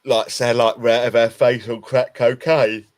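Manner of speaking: playful, loud exaggeration; mock tragic, tongue in cheek; joking exaggeration. Joking exaggeration about being wasted.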